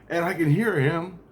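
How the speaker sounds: ordinary talk, nothing marked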